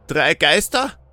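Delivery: mock the threat with a grin; mischievous. A narrator's reading. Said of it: "mit einem schelmischen Grinser".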